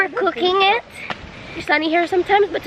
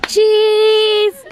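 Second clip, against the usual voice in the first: calling out; 3 semitones higher, 7 dB louder than usual. Real, not acted. Posing for photo, calling out.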